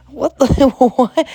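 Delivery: loud, high laugh breaking the words; laughing disbelief. Real, not acted. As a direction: burst out laughing mid-question. Laughing at baby name Trout.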